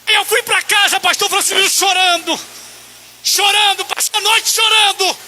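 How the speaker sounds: high, loud, fervent preaching; impassioned pulpit testimony; preaching at full force. Real, not acted. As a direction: build to an emotional climax from the pulpit. Preacher recounts tearful revival.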